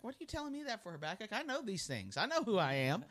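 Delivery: high, voiced God brushing Habakkuk off; God's mock reply. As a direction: play God as a bit put out, pitched well up. God voiced mockingly in sermon.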